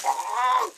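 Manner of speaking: high, loud startled "Ah"; choked cry. A narrator's reading. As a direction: gasp it out in shock. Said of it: "he said".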